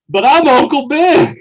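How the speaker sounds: high, loud mock protest; hammed-up sketch character outburst; Uncle Ben. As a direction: belt it out as an indignant caricature. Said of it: "And then Keenan goes".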